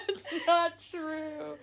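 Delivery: high, slow protest through laughter; laughing denial. Real, not acted. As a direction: deny it while cracking up. Laughing denial of scam claim.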